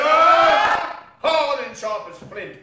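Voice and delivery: very loud and slow, each word hammered; emphatic declaration. A narrator's reading. Narrator condemning Scrooge's hardness, booming. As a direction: pound it out like striking flint.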